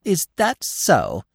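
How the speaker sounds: slow, high, pettish squirrel. A narrator's reading. Squirrel answering the doubting mother, pettish. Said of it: "the squirrel said pettishly".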